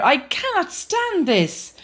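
Mister Bear sick of the noise; exasperated Mister Bear. Acted. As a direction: drag it out in despair. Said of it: "said Mister Bear".

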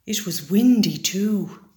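usual speaking voice

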